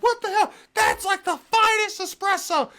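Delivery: high, loud, indignant yelp; mock outrage. Mobster outraged that his fine espresso gets spat out, high mock indignation. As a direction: squeal it like an insulted espresso snob.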